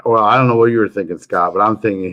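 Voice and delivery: ordinary talk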